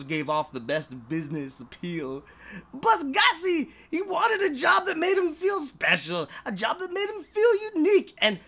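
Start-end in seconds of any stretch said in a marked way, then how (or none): 2.7-8.1 rising passion. A narrator's reading. Narrator swells with emotion.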